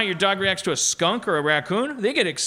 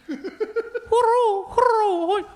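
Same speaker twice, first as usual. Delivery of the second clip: high, wavering mock coyote howl; hammed-up coyote cry; 4 dB louder than usual. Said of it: "No, no, it does not".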